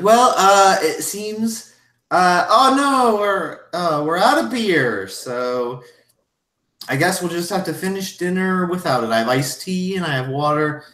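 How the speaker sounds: put-on high, flustered older lady voice; a confused hostess losing the thread. Missus Garrison bewildered by the table's silence, high and flustered.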